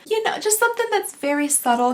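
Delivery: ordinary talk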